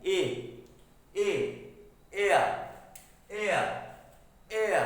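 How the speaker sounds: ए said alone, clear of any blockage; vowel sound demo. Shown as a demonstration. Teacher showing students an unobstructed vowel, ए sounded out alone. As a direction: sound out ए as a separate open vowel. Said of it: "यो हम बोलेंगे ए".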